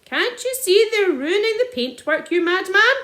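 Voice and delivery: high, loud outrage; furious shout. A narrator's reading. Landowner raging at the sergeant, loud. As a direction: rage at the madman.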